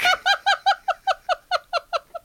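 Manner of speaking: helpless high-pitched laughter; big high laugh. Real, not acted. Friend cracking up over the book, laughing hard. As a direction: burst out laughing at the joke.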